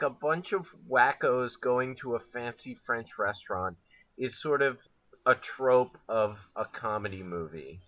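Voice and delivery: ordinary talk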